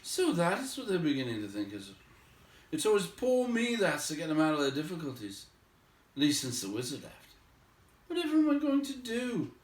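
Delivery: a worried hobbit spiralling; fretting hobbit. Acted. Bilbo frets to himself.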